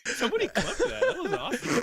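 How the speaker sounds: laughing delight. Real, not acted. Player laughing as he asks for a clip: laughing delight. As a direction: crack up while hyping the play.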